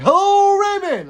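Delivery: loud, high, drawn-out holler; yell on request. Shown as a demonstration. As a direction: yell a big hello across the yard. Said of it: "Like, yell it?".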